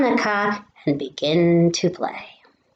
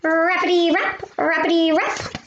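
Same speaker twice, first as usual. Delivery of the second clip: high, yappy bark voice, much higher; dog barking; 9 semitones higher than usual. Acted. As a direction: yap it out like a little dog. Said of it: "and barks".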